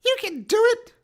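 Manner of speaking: high mocking dare; taunting creature. GM as the tree taunting the party, high mocking dare. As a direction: goad the heroes into attacking.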